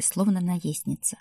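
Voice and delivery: ordinary delivery, nothing marked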